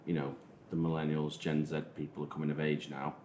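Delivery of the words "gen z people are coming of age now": ordinary talk, nothing marked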